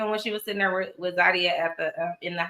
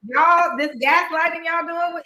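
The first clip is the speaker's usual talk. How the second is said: exasperated call-out; 10 semitones higher, 5 dB louder than usual. Retold or imitated. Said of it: "I was like".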